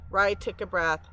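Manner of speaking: plain reading voice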